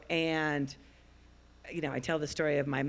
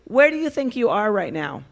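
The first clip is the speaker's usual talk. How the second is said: loud, higher-pitched question; calling out; 5 semitones higher, 11 dB louder than usual. Real, not acted. Daughter asks Dad where he thinks he is, calling out. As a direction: project it clearly to someone far off. Asking her distant father aloud.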